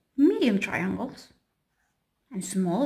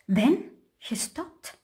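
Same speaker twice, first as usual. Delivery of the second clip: ordinary delivery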